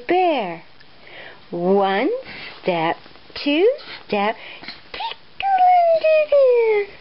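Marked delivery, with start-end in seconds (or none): 4.9-6.9 squealing tickle. Acted. Tickle climax.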